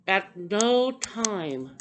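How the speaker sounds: "no time" slow, each word leaned on; stress on no time. Real, not acted. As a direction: drag out "no time" for emphasis. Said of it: "this is what I wanna stress".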